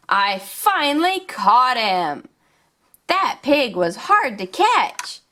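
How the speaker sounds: put-on upbeat, sing-song storytelling for little kids; toy play narration. Showing toys to young viewers, upbeat and sing-song. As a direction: play along like a kids' toy show host.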